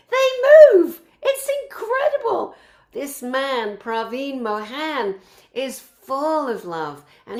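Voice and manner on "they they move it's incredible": very high, laughing through the words; real delighted laughter, much higher and louder. Real, not acted. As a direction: giggle out the amazement, can't hold it in. Laughing in amazement at temples.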